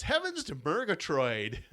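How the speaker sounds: high, slow cartoon lion voice; Snagglepuss.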